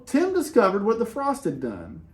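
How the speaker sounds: usual speaking voice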